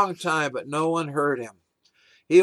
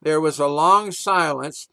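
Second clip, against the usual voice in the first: usual speaking voice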